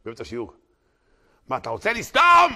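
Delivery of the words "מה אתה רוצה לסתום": sudden furious shout; צעקה. Retold or imitated. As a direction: yell it as the angry rabbi. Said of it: "ואז באותו צעקה". (צעקה חזקה של הרב)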